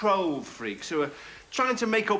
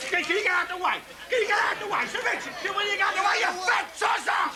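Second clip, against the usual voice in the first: angry photographer yelling; 11 semitones higher than usual. Acted. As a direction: bellow abuse at whoever blocks the shot.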